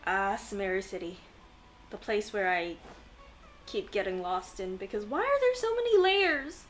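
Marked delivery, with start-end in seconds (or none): 5.0-6.6 loud, high wail of playful frustration; exasperated complaint. Real, not acted. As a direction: whine it loudly at the maze of a city. Mock complaint about confusing city.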